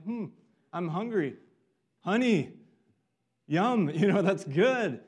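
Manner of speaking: a hungry man who finds a treat, yum; delighted honey. Retold or imitated. Jonathan finding honey, voiced.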